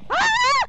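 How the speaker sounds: piercing high shriek of terror; terrified scream. Real, not acted. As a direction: scream in sheer panic. Ghost hunt attack, screams.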